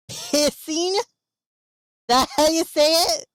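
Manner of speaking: amused disbelief. Real, not acted. Mocking friend's pronunciation.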